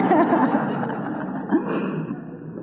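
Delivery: soft breathless laugh with a rising lift. Real, not acted. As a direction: laugh quietly, almost under the breath. Laughing about the hot shower.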